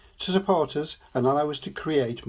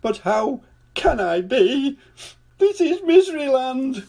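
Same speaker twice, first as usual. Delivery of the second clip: crying king; 13 semitones higher, 4 dB louder than usual. A narrator's reading. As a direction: cry it out in despair. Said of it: "cried the king".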